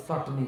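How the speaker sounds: চাটনি with a soft s in place of the চ; quiet imitation of চাটনি said with s; Assamese চাটনি. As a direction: say চাটনি the way Assam people do, without the চ. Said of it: "চ উচ্চারণ করতে পারে না".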